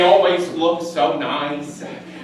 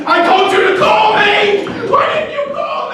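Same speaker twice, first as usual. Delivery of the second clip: yelled much higher and louder; angry shout; 7 dB louder than usual. Acted. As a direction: scream the blame at him. Angry reproach over ruined dinner.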